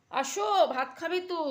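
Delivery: loud, high call across the room. Real, not acted. Calling Kutus to come eat rice, loud high call.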